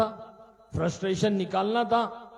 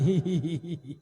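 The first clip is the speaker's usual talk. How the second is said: put-on slave girl laughing. Slave girl giggles while being whipped. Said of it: "फिर हंस रही".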